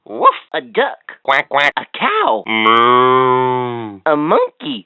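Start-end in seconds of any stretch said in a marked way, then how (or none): 2.5-4.0 cow moo. Acted. Animal sounds game.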